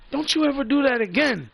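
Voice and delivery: scared son scolding. Retold or imitated. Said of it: "my son was like".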